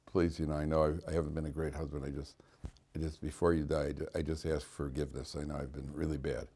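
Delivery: low, hushed retelling of a husband's confession; retold quiet plea. Husband asking his dying wife for forgiveness, quiet plea. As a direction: softly imitate a halting, apologetic plea.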